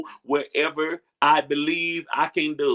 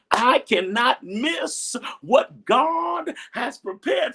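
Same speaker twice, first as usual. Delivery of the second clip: high, slow, crying shout; preaching cry; 10 semitones higher, much slower than usual. Real, not acted. Pastor declaring to congregation God's plan, slow crying shout. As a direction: cry it out to the church.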